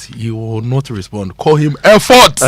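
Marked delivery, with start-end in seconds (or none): 1.4-2.4 loud, higher-pitched call of EFFORT; big emphatic shout; calling out a name. Acted. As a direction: belt out the name Effort like hailing him. Mimicking how friend's name is called.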